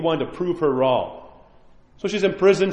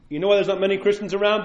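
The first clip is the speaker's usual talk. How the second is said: normal talking voice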